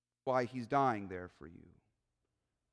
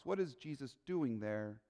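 normal talking voice

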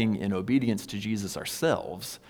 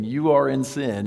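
finger-wagging scold; 4 semitones higher, 5 dB louder than usual. Retold or imitated. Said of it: "wagging your finger and saying".

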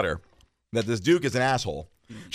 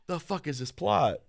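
mock baffled; 5 semitones higher, 4 dB quieter, slower than usual. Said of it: "I'm like".

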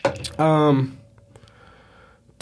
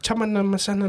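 made-up Spanish-sounding gibberish; fake foreign language; 6 semitones higher, 4 dB quieter, faster than usual. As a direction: rattle off nonsense like it's Spanish. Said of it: "Made up shit that's not even Spanish".

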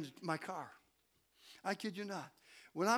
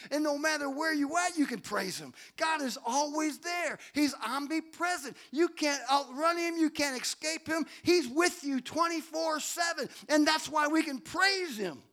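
raised, rising sermon delivery; emphatic preaching; 6 semitones higher, 4 dB louder than usual. Real, not acted. Preacher telling the church God is always there, emphatic preaching. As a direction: drive each point home higher.

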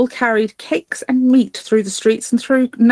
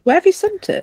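eager high question; 10 semitones higher than usual. Real, not acted.

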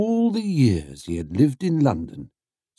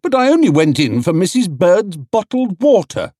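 higher, louder indignant bear; protesting Paddington; 10 semitones higher, 6 dB louder than usual. A narrator's reading. Paddington protests to the crowd, indignant. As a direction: plead innocence.

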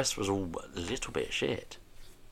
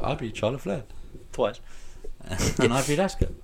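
higher, mock-proud bragging Paige; boasting Paige impression; 7 semitones higher than usual. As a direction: brag it like Paige gloating on TV. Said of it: "where she was like".

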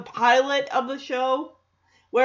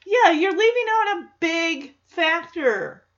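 ordinary talk

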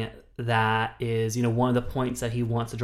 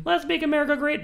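much higher, proclaimed with LET'S stressed; mock rally slogan; faster than usual. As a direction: belt "Let's make America great" like a campaign cry.

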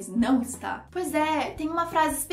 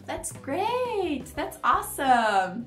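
shared joy; 2 semitones higher, much slower than usual. Acted. Mother answering her child's good news, shared joy. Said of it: "Alegria compartilhada".

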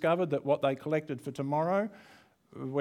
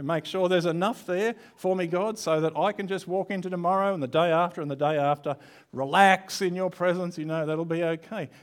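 mock greedy prayer; 4 semitones higher, 4 dB louder than usual. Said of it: "this is how I'd like to pray".

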